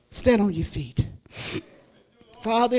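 ordinary talk

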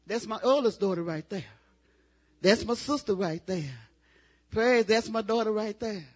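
ordinary talk

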